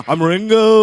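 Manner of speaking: put-on Liverpool accent.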